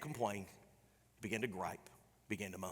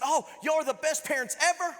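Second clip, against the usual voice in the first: high, delighted gush; a thrilled kid praising his parents; gushing boy; 11 semitones higher, 4 dB louder than usual. Retold or imitated. Boy telling his dad, high and delighted.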